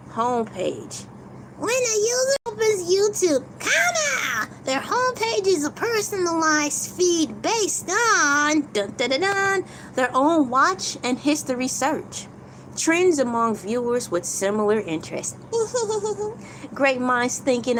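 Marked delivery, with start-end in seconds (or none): 1.6-12.3 mock crying read. Reading like crying voice. 15.4-16.7 high laugh. Real, not acted. Laugh after reading.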